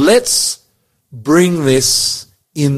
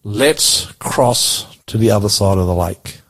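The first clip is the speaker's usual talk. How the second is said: slow and lower, with pauses between phrases; measured, lower scripture reading; slow, pointed reading; 5 semitones lower, slower than usual. Shown as a demonstration. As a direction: read it deliberately so the listener catches the clue. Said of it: "see if you can pick it up".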